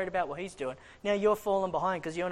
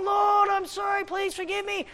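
desperate plea; 12 semitones higher, 4 dB louder than usual. Retold or imitated. The preacher begging the Lord to forgive, high desperate cry.

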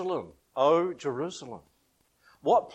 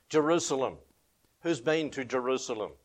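ordinary delivery

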